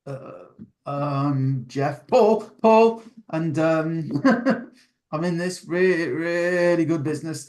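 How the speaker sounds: mock awkward self-introduction to camera; nervous first video. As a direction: imitate a flustered customer fumbling their own name. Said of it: "they do that thing where it's like".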